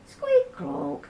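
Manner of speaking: high squeaky squeak, then a clonk; playful squeak-clonk sound effect; squeaky bike noise. A narrator's reading. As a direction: voice the wonky pedals, squeak high.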